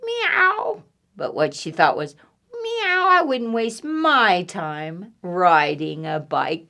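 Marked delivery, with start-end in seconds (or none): 0.1-0.8 high drawn-out meow; cat meow. Acted. Cat answers duck's greeting, high meow. 2.6-6.7 disdainful cat. Acted. Cat's thought voiced in story.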